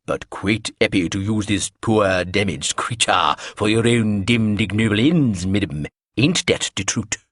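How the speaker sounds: slow, intoned, in a put-on mannish voice; deep masculine voice. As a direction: intone it slowly, like a solemn man. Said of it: "in her deep, masculine-sounding voice".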